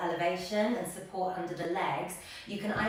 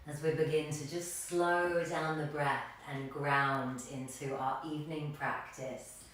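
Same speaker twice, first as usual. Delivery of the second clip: guided meditation; 3 semitones lower, 4 dB quieter than usual. Real, not acted. Yoga teacher settling a class into stillness, guided meditation. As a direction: settle them gently into stillness, voice easing down.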